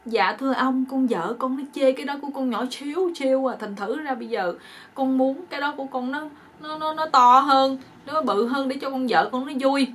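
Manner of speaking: imitated sorry whine; mock tearful plea. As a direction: plead pitifully with the fairy, playacting. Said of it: "Tại sao con khóc?".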